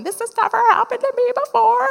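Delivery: high-pitched, acted-out amazement; amazed excitement. Speaker holding the unexpected check in hand, amazed excitement. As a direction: squeal it high, reliving the thrill.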